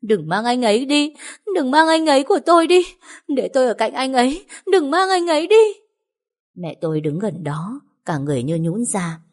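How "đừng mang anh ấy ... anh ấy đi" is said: high, loud sobbing cry of begging; wailing plea. A narrator's reading. Woman clinging to the coffin, begging in a high loud wail. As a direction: wail it out, begging them not to take him. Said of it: "vừa bấu tay lên chiếc quan tài vừa gào khóc".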